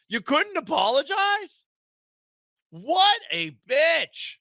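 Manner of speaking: high indignant shout, slow on the insult; furious disbelief; outraged shout. Real, not acted. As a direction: yell at him in disbelief. Host ranting at suspect.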